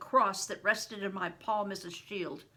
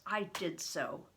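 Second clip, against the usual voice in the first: ordinary delivery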